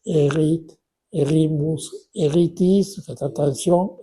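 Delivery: usual speaking voice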